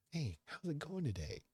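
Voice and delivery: high, bubbly mock greeting; sing-song fake-friendly hello; cheery waiter voice. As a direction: mimic an overly chipper server. Mimicking cheerful staff greeting.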